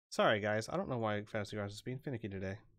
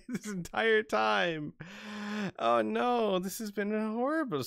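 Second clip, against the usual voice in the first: dismayed realization; 11 semitones higher, 4 dB louder than usual. Real, not acted. GM realises nobody heard them.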